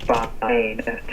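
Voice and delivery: radio cutting in and out; broken up transmission; glitching audio. Real, not acted. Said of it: "you keep cutting out".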